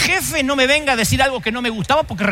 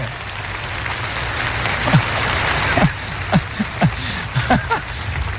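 laughter; 5 semitones lower than usual. Real, not acted. Laughing at his own story.

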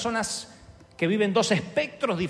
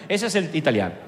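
ordinary delivery

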